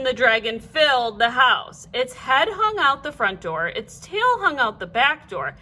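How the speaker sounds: plain reading voice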